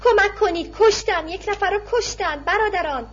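a beaten man calling out for help; tearful shout for help. A narrator's reading. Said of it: "با صدای گریان فریاد کشید". (فریاد بلند و گریان برای کمک)

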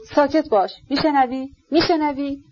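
a man startled by a sound; frightened and hurried. Acted. Rogozhin hushing the prince in fright. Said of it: "با لحنی پرشتاب ... وحشت زده".

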